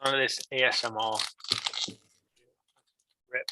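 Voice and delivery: ordinary talk, nothing marked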